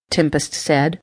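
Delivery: ordinary delivery, nothing marked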